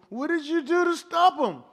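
put-on high, whiny little-boy voice, slowing at the end; crying little boy. Preacher voicing a little boy complaining to his brother, high and whiny. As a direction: play a hurt kid telling on the bully. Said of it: "And so the boy says".

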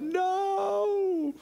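very high, long drawn-out mock wail; crying out. Young son interrupting dad's pet story, mock crying out. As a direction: imitate a small boy wailing in protest. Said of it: "Benjamin cries out".

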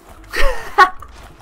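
shrieking burst of laughter; loud high laugh. Real, not acted. Gamer shrieking with laughter at the missing car. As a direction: crack up loud.

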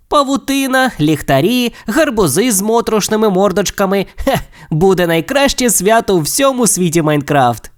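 high, eager character voice; excited planning. A narrator's reading. Steve planning decorations for his house, excited and high-pitched. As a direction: list it with mounting relish.

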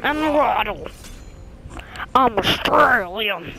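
slow, exaggerated Australian character voice; mock Australian accent. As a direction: drag out the words in a hammed-up Australian accent. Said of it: "He kinda talks like this".

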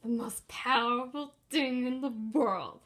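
scornful sneer; sneering mocking repeat. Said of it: "mocking repeat of the cure".

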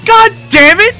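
loud, frustrated curse; angry outburst. Real, not acted. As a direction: blurt it out in real irritation. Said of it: "Oh, I'm irate right now".

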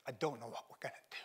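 fast, breathless whisper of a broken father; a father's hushed confession about his dying wife; whispered despair. Retold or imitated. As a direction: let it fall to a whisper. Grieving dad confesses wife dying.

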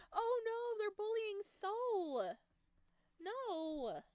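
high, loud, drawn-out dismay; loud, slow wail of distress; alarmed outcry. Real, not acted. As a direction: cry out in protective alarm. Upset seeing favourite character bullied.

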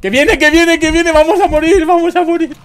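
very high terrified screaming; panicking gamer. Real, not acted. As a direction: shriek in full panic. Monster coming, player screams.

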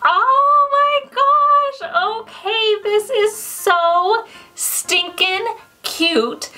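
high, slow, drawn-out squeal; stretched-out gushing squeal; squeal of delight. Real, not acted. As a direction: squeal with real delight over something cute. Squealing over an unboxed ornament.

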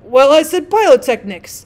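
loud, slow, stern retort; severe. Acted. Rocket answers the Bengal light's correction, severe. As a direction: put the other one down severely. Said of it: "in a severe tone of voice".

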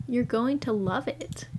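usual speaking voice

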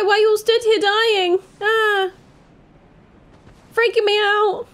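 high, loud, fast frightened crying out; real scared yelps, high and loud; terrified. Real, not acted. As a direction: panic at the corpses. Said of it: "I'm terrified in these woods".

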